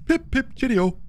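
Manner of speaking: high, hammed-up British toff phrase; bad British accent. Streamer obliging teammate who wants British, poor British accent. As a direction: attempt a cheesy British accent. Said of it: "I want, like, a British accent or something".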